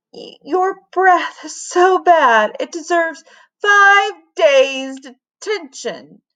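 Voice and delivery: high, slow, breathless horror; stunned principal, pitched high; gasping principal. A narrator's reading. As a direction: gasp it out, reeling from the smell. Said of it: "gasped Missus Struggles".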